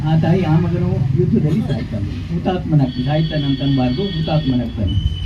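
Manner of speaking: hushed, solemn. Real, not acted. Speaker telling of a mother's son martyred in war, hushed. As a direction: drop the volume in respect for the dead.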